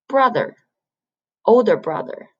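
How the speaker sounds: ordinary talk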